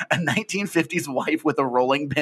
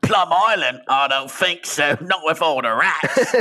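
slow, raised Cockney put-on; Cockney Hannibal Lecter; 5 semitones higher, 4 dB louder, slower than usual. Sweep Hannibal turning down Plum Island, slow hammy Cockney. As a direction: refuse in slow, hammed-up Cockney. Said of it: "Hannibal Lecter as the Cockney".

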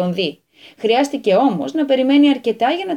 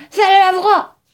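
loud, higher howl; howling yell; 7 semitones higher, 6 dB louder, slower than usual. A narrator's reading. Trapped old man howling at the landowner. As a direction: howl that you want out. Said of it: "ούρλιαξε ο Μπαρμπαντίμα".

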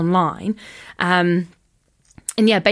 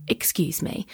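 much softer retold aside; small, polite voice; 9 dB quieter, slower than usual. Excusing herself to the annoyed stranger, quietly. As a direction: drop the volume for a meek interruption.

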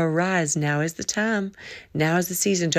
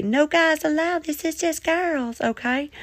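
mock exclusion; 9 semitones higher than usual. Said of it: "I ain't gonna say".

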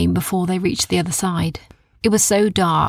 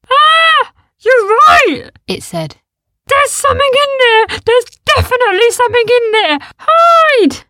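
very high, loud panicked yelp; panicked shout; 10 dB louder than usual. A narrator's reading. The beast warns the others to hide, shrieking.

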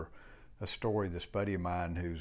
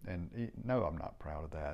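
ordinary talk, nothing marked

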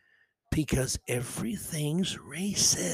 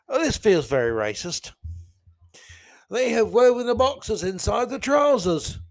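mock posh British accent, pitched up at the end; 7 semitones higher than usual. Podcaster quoting a TikTok video in mock British accent. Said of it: "I do do a British accent very well".

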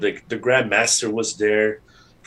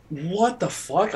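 stunned disbelief; 7 semitones higher, 4 dB quieter, slower than usual. Retold or imitated. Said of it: "I was like".

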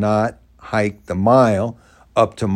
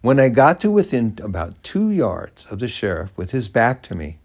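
ordinary talk, nothing marked

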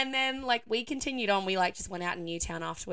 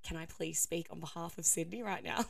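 calm; 3 semitones lower, 7 dB quieter than usual. Retold or imitated. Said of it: "I walked calmly".

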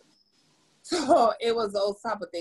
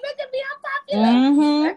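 high, loud, showy brag; mock proud boast; 12 semitones higher, 7 dB louder than usual. Guest boasting about landing on the Explore page, mock proud. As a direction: show off like a suddenly famous star. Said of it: "I'm like".

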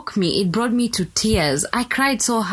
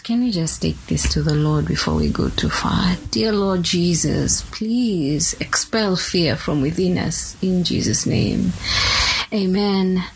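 prayer; 4 semitones lower than usual. Acted. Turns to lead a prayer.

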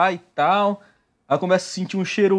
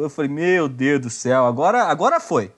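ordinary delivery

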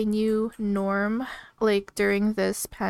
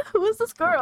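much higher, incredulous onlooker; high-pitched teasing disbelief; mock astonishment; 10 semitones higher than usual. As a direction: play a bystander baffled by someone's voice. Joins in imitating startled onlookers.

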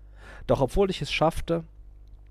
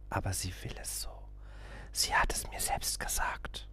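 much softer hushed whisper; secret whisper; 5 semitones lower, 10 dB quieter than usual. A narrator's reading.

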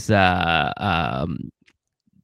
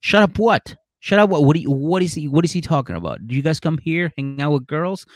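higher, fast accusing questions; suspicious girlfriend; 4 semitones higher than usual. Retold or imitated. Imitating the girlfriend demanding answers about the shut up. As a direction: grill him, rising suspicion. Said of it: "And Isabella's like".

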